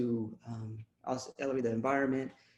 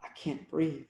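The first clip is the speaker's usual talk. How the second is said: poem recital; 3 semitones higher than usual. Acted. Poet voicing Eric Garner's words, fading repetition. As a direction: let each repeat grow fainter.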